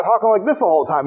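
quick, goofy numb-tongue speech; numb-mouthed talk. Retold or imitated. Storyteller showing the goofy effect of the fruit, numb-mouthed. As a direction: let a numb tongue garble the words. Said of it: "makes your tongue go numb".

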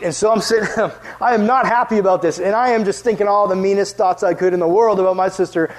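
normal talking voice

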